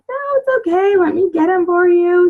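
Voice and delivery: high, coaxing insistence; insistent helper. Retold or imitated. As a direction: talk her into taking the tissues. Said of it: "I'm like".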